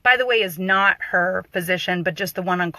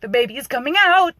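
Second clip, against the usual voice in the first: higher and louder panicked cry; imitating panic; 6 semitones higher, 4 dB louder than usual. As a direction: play the frightened mother in labour. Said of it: "she is panicking".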